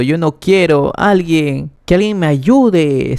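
mildly distressed storyteller's cry for help; trembling plea. A narrator's reading. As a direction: plead for help, drawing out the end. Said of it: "Ella estaba temblando y seguía diciendo".

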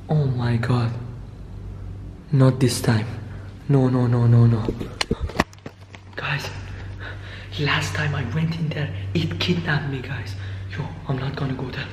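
very low, muted voice; hushed dread. Acted. Vlogger telling viewers of earlier kidnapping, low and hushed. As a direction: keep it low, just above a whisper.

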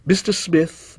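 usual speaking voice

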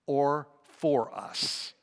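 ordinary talk

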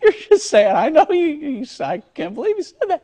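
laughing. Real, not acted. Teasing co-anchor through laughter.

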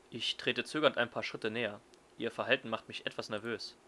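normal talking voice

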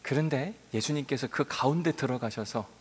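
usual speaking voice

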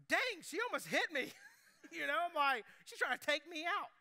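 half-joking startled outburst, pitched high. Retold or imitated. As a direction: jump back in alarm. Startled by newspaper thrown.

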